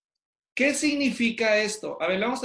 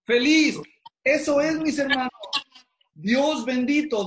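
excited affirmation; 6 semitones higher, 4 dB louder than usual. Real, not acted. Teacher affirming the class's answer.

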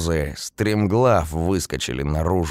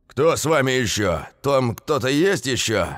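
deafening shout; 8 semitones higher than usual. A narrator's reading. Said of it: "оглушительно кричать дядька Петя".

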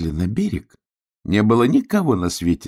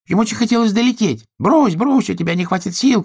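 little duck voice; 14 semitones higher, 5 dB louder than usual. Acted.

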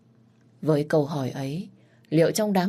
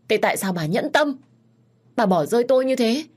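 bitter accusation; 6 semitones higher, 4 dB louder than usual. Acted. Abandoned child accuses mother.

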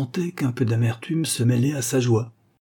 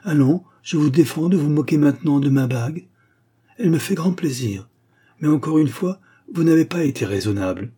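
higher, louder mock scolding; young woman scolding fondly; 6 semitones higher, 4 dB louder than usual. A narrator's reading. Girl playfully scolding her neighbour, higher and louder. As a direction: forbid him to mock, playfully stern.

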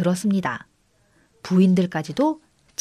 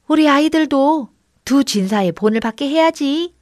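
animated, raised villagers' chatter; admiring praise; 4 semitones higher, 4 dB louder than usual. A narrator's reading. Villagers holding up the two friends as models, admiringly. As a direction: praise them with loud enthusiasm. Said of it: "두 친구를 칭찬하면서".

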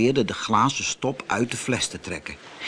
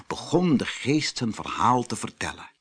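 plain reading voice

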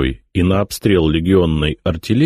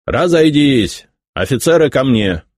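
shouting drill sergeant; 7 semitones higher than usual. Acted. Major dismisses the formation.

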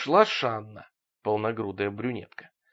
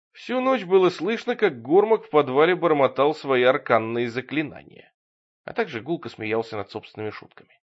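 usual speaking voice

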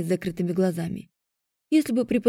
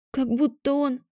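higher, faltering, left unfinished; 5 semitones higher than usual. A narrator's reading. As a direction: let the voice waver and break off. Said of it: "Мой голос дрогнул". (дрогнувшим голосом, обрывая фразу)

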